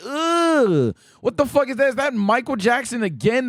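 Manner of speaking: high-pitched, exaggerated revulsion; hammed-up grossed-out reaction; mock disgust. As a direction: recoil with a long "ugh", then baffled disbelief. Re-enacts disgusted first reaction to design.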